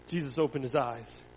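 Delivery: animated storytelling that rises and falls with the drama; story narration. A narrator's reading. Pastor reads a Bible story to children, animated storytelling. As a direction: read it to children, pausing for effect.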